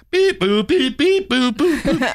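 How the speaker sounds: high, silly beep-boop noises; silly clown beeps. Retold or imitated. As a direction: chirp out the button tones. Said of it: "and I was sitting there".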